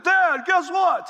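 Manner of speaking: can't hold in the good news; excited news. Retold or imitated. Calling dad with big news.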